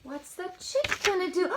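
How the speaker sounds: ordinary delivery, nothing marked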